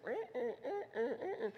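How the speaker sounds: high squeaky creak of a broken wheel; high eh eh creak like a bent axle; wobbling wheel noise. Retold or imitated. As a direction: mimic a wobbling busted wheel.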